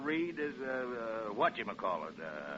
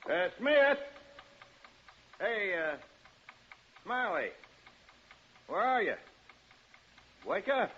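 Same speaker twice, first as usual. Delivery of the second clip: calling out; 7 semitones higher than usual. Acted. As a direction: shout up at the windows. Calling into empty house.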